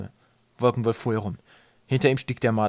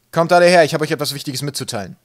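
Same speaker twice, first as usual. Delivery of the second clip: loud, raised commanding call, easing off; 5 semitones higher, 10 dB louder than usual. A narrator's reading. Captain gathering his crew for news, calling out. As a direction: summon everyone with a big opening shout.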